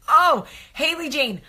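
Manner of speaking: gushing. Real, not acted. Gushing over a viewer.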